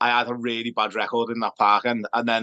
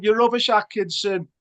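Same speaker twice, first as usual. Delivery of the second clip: mock fan heckle; 10 semitones higher than usual. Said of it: "What was the other one?".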